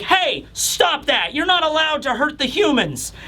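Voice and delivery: high-pitched bossy child, lightly acted; scolding kid. Giant kid defending the humans, indignant scolding. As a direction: pitch it up and tell them off.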